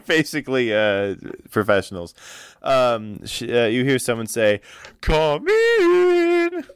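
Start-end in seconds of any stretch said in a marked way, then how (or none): 5.0-6.6 put-on high, far-off call of an older woman; noblewoman calling out. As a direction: sing out an invitation from behind the door. Olga answers from inside.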